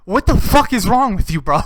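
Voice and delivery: loud exasperated outburst. Real, not acted. Ranting about the coworker who recommended the show, loud and exasperated. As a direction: blast him with disbelief.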